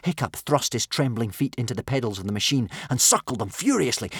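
ordinary delivery

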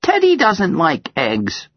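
put-on high, slow child announcement; announcing child. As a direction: declare it like a proud little girl. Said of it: "she announces".

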